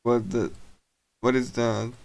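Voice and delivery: usual speaking voice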